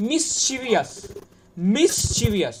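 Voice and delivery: মিসচিভিয়াস as "mis-CHI-vi-as"; four syllables in মিসচিভিয়াস; extra "i" before the ending of মিসচিভিয়াস; common mispronunciation. Shown as a demonstration. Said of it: "অনেকে এটা উচ্চারণ করো".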